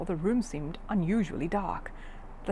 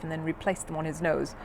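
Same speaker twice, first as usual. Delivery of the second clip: ordinary delivery, nothing marked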